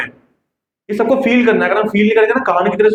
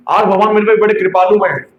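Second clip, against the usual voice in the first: ordinary talk, nothing marked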